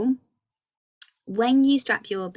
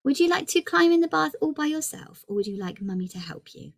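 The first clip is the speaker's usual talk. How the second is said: cheery mum voice; 10 semitones higher than usual. Retold or imitated. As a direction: brightly offer a toddler two options. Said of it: "and then I said".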